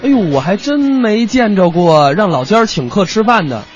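usual speaking voice

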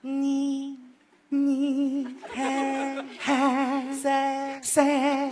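你 嘿 塞 drilled as sound demos; nasal consonant demo. Shown as a demonstration. Teacher drilling nasal consonants to student, exaggerated. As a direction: model 你, 嘿, 塞 as isolated sounds. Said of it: "下面教你鼻辅音".

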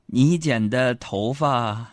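slow, halting confession to mom; acted careful, stretched-out delivery; held-back hesitation. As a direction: drag the words out as if reluctant to say them. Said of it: "但是我就忍了忍".

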